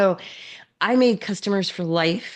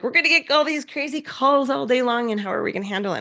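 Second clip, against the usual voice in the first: panicky employee; 6 semitones higher than usual. Retold or imitated. Employees freaking out.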